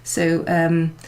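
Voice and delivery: ordinary talk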